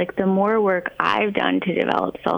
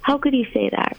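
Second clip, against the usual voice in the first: imitating a parent rushing to reassure, pitched up; higher-voiced, over-reassuring mock parent; doting parent; 6 semitones higher than usual. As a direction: gush over a child in protest, voice held high. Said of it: "they're quick to say".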